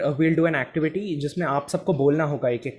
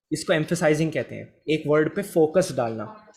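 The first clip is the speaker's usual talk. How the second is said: normal talking voice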